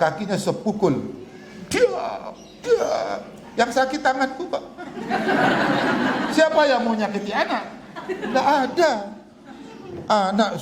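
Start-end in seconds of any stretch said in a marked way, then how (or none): 1.7-2.9 put-on high crying yelp of a child; child's cry. Imitating his son crying out from the smack. 3.6-8.9 speaking through a chuckle; laughing. Real, not acted. To the congregation, laughing while speaking. Laughing aside after story.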